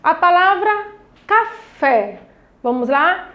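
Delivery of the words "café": ca-FÉ in two beats, FÉ firm; "café" broken syllable by syllable for children; separated syllables. Shown as a demonstration. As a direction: split "café" slowly, leaning on the final fé. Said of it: "a senhora tá falando separando".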